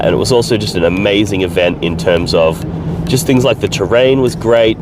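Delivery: ordinary talk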